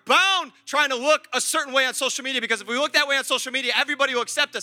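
loud, hard-hit sermon delivery; forceful preaching. Real, not acted. Preacher warns youth about social media approval, forceful preaching. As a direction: drive each phrase home to the crowd.

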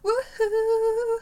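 high loud woohoo; excited cheer. Real, not acted. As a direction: cheer it out with joy. Cheering for festival.

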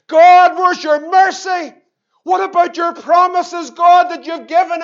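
loud pleading shout; crying out to God. Acted. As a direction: call out to God, demanding his mercy.

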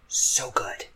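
soft savoring whisper. Real, not acted. Savoring the memory of the burger for the listener, whispered. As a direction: breathe it out like a secret pleasure.

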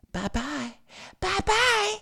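very high, far-off squeaky farewell; mock falsetto goodbye; sing-song mock bye. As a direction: wave them off in a squeal. Mocking goodbye.

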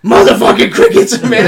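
laughing, breathy curse at the crickets; half-laughing grumble of annoyance; mock exasperation. Retelling his rant about crickets.

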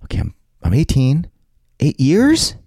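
high, loud, rising disbelief; can't believe the terms you signed; incredulous. Retold or imitated. Retelling disbelief at enlistment terms.

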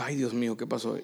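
reacción baja y grave; susto por lo bajo. Retold or imitated. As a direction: decirlo para sí, casi sin volumen. Retold reaction muttered to himself. (sobresalto contenido, en voz baja)

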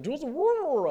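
high, loud imitation of girls offering a drink; shouting over band. Girls offering a bottle while the band plays, mock muffled shout. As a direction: yell it so the words blur in the noise.

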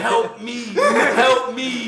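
high, loud mock plea through laughter; giggling fake distress call; laughing plea. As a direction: beg for help while cracking up. Retelling a desperate phone plea.

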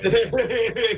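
high burst of laughter; loud laugh. Real, not acted. Reacting to friend's surprise.

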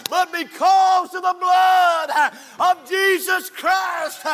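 fiery pulpit shout with stretched-out key words; full-force preaching. Real, not acted. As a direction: drive each phrase home to the congregation.

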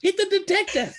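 very high squeaky holler; squeaky yell. Acted. Dean orders Landry to use the detector, squeaky yell. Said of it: "this squeaky voice yelling from the door".

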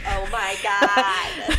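loud, long-drawn fangirl squeal; hammed-up preteen gushing, slow and loud; seventh-grade fangirl. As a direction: stretch it out like a starstruck seventh grader. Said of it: "Seventh grade me is going".

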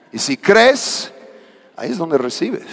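high ringing cry on "Y si crees"; preaching climax. Real, not acted. ("Y si crees" alzado y lento, con fervor)

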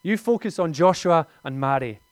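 a hint of an insistent mum; mother's voice. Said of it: "I was gonna do a Northern Irish accent. I don't think I will".

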